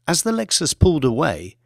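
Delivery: ordinary delivery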